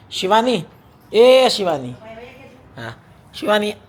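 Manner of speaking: loud, high, drawn-out call. Real, not acted. Husband calling his wife that the ice cream is here, loud call. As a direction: call her name across the house, draw out ice cream.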